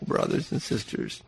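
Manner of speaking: hushed, breathless whisper to the congregation; whispered in awe to the flock; awed whisper. Real, not acted. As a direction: drop to a whisper, full of wonder. Awed hush after quote.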